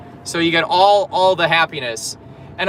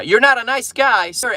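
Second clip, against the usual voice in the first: mock outrage; 8 semitones higher, 4 dB louder than usual. Real, not acted. Teasing a viewer's comment.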